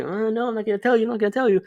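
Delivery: lightly voiced crush holding back; imitating the crush refusing to tell him; teasing refusal. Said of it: "She was like".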